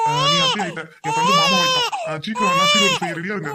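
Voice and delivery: loud, high-flying elation; overjoyed. Acted. Friend celebrates becoming an uncle, loudly overjoyed. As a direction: shout the good news to everyone.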